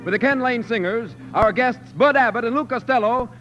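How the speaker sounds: normal talking voice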